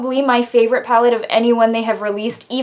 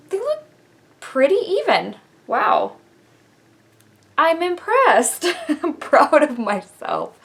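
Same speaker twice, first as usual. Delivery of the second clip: pleasantly surprised; 3 semitones higher than usual. Real, not acted. Checking if her eyeliner wings match.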